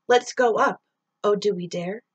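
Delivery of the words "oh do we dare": ordinary delivery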